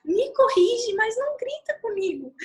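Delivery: put-on high-pitched child's protest, loud at first; imitated pleading daughter. Mother repeats how her daughter begged her, high childlike plea. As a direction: mimic a small child pleading, well above your own pitch. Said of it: "Ela falou assim".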